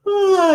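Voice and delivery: big, loud yawn sound starting high; imitated yawn. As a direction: let out a huge mock yawn. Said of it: "dragonflies can yawn".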